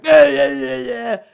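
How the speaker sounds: loud, high mock whining; hammed-up jabbering over her; protesting boyfriend. Man blocking her at the door, mock whine.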